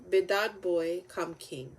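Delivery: usual speaking voice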